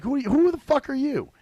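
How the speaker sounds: affronted who-are-you outburst; indignant challenge. Acted. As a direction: confront a stranger, pitched sharply up. Mock confronting an unknown messager.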